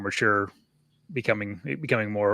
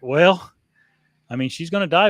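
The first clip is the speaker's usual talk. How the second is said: mock frank answer; 6 semitones higher, 6 dB louder than usual. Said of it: "And I'm like".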